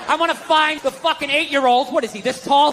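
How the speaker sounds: ordinary talk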